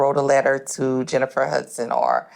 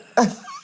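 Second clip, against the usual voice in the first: laugh; 8 semitones higher than usual. Real, not acted. Laugh heard.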